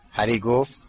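ordinary delivery

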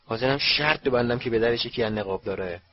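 usual speaking voice

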